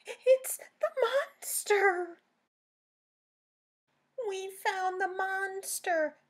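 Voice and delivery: high, loud thrilled yell; excited shout. Acted. Daisy and Pip yelling that they found the monster. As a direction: shout it out in discovery.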